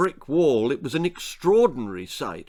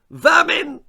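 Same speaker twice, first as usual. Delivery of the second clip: very high, loud shriek; 8 dB louder than usual. Acted. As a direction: screech the word in disgust. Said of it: "shrieked the lady".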